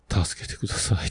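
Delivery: faint breathy whisper, barely voiced; a trapped old man begging for help in a whisper; small weak voice. Old man under his bike to passerby, faint whisper. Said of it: "小さい声で".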